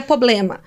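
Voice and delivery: "problema" pronounced "poblema", first r missing; non-standard pronunciation. Shown as a demonstration. Linguist showing how this person pronounces it, "problema" as "poblema". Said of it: "Por que que ela fala desse jeito?".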